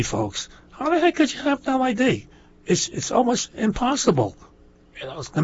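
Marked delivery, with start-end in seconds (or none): 0.7-4.4 exasperated disbelief. Real, not acted. Exasperated rant about voter ID.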